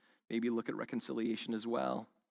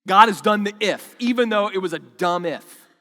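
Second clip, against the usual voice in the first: loud, high, insistent preaching; fervent preaching; 6 semitones higher, 12 dB louder than usual. Acted. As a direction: drive the point home loudly. Preaching peak about the promise.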